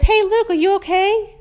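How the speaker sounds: mother calling out to her son outside. Retold or imitated. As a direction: hail him from a distance, bright and loud.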